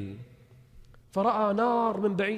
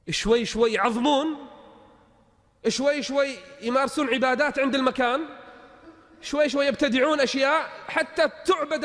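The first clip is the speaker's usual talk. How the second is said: preaching louder and higher, line by line; rising preaching; 8 semitones higher, 4 dB louder than usual. Acted. Preacher warning the crowd about grave worship, climbing to full force. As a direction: build each شوي شوي into a warning.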